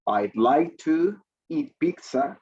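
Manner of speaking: teacher modelling a sentence slowly; slow model sentence. Shown as a demonstration. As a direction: spell it out slowly for the class. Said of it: "So we have two ways to say that".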